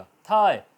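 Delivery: "थाय" said loud and clear as thigh, gh silent; teacher calls out "थाय" with a silent gh; clear demo word. Shown as a demonstration. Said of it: "थाय बोला जाएगा".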